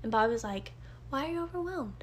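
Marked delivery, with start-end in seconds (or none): 1.1-2.0 soft, higher-pitched mimicked question; put-on innocent asking, hushed; mock clueless husband. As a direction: lightly imitate a clueless partner asking.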